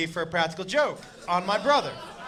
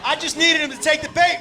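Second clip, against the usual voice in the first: ordinary talk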